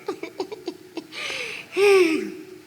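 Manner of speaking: high staccato sobs into a wail; hammed-up high crying; mock crowd sobbing. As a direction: imitate old women bawling. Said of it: "Semua oma-oma nangis".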